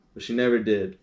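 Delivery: plain reading voice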